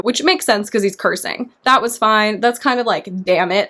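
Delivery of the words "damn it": ordinary talk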